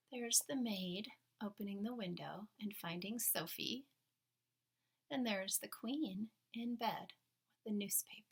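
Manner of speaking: unvoiced whisper; hushed aside to listeners. Real, not acted. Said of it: "whispered aside".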